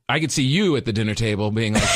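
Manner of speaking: ordinary talk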